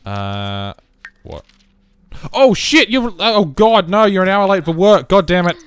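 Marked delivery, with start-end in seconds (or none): 2.3-5.6 real high, loud outburst of panic; alarmed shout. Real, not acted. Player finds their character is late for work, alarmed shouting. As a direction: yell in sudden dismay. Sudden discovery of being late.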